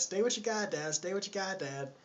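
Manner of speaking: high-pitched mock bossy woman. Lady tells baby to stay with goddad, mock bossy. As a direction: imitate a woman ordering a child to stay.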